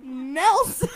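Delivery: high, loud laughter breaking the name; can't stop laughing; still giggling. Real, not acted. Reader still laughing after the slip.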